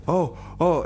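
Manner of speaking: ordinary talk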